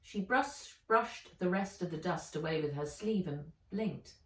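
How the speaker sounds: ordinary delivery, nothing marked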